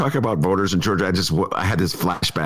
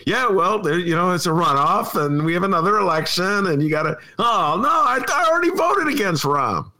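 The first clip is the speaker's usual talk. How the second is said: much higher mock exasperation at a voter; exasperated self-retold; 9 semitones higher than usual. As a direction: throw up your hands at the voter. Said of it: "a moment of like".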